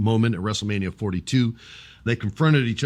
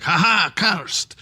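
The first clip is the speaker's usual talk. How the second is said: taunting Danhausen; 8 semitones higher, slower than usual. Retold or imitated.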